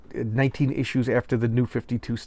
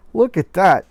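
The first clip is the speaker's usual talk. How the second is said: mock excited; 6 semitones higher, 7 dB louder than usual.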